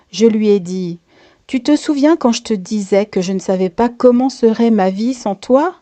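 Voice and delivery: ordinary delivery, nothing marked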